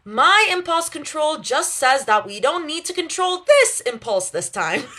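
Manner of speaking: high, bright proud declaration. A narrator's reading. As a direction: announce it with glee. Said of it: "then adds proudly".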